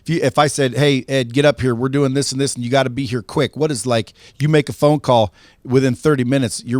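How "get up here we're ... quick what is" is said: normal talking voice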